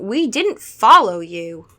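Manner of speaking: loud, slow accusation; emphatic, accusing denial; accusing protest. A narrator's reading. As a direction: push back loudly and slowly. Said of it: "accusing him".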